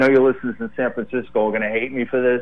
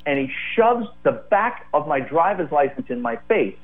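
ordinary delivery

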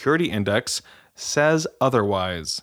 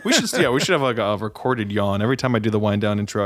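usual speaking voice

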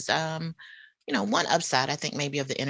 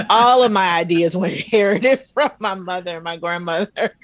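laughing; 3 semitones higher, 8 dB louder than usual. Real, not acted. Laughing at her inherited ideas.

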